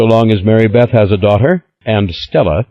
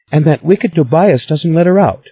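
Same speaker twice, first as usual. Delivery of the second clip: put-on high, lively girlish voice; a lightly voiced excited young Stella; eager young woman; 10 semitones higher, 3 dB louder than usual. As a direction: spill the gossip breathlessly.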